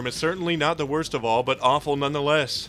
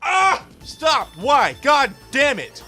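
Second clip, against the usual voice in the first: much higher, louder pained yelling; anguished yelling; 11 semitones higher, 6 dB louder, much slower than usual. Acted. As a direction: scream in disgust. Reacting to awful singing.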